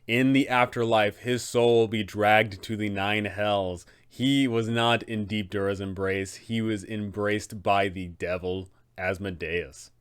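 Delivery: ordinary delivery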